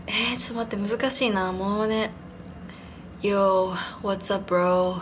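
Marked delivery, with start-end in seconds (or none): 3.2-5.0 low, laid-back surfer guy drawl; an attempt at a cool, low-voiced surfer bro; surfer impression. Shown as a demonstration. Podcaster attempting an impression of handsome surfers speaking English, low laid-back voice. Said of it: "声は少し低めで".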